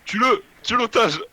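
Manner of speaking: high loud mock order; shouting. Urging teammate to kill hostage.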